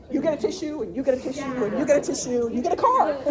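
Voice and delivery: high, loud game-show giveaway voice; mock Oprah giveaway, building to the car. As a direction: hand out tissues like a loud TV prize host. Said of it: "like Oprah".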